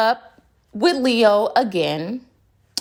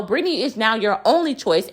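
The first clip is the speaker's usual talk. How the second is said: ordinary talk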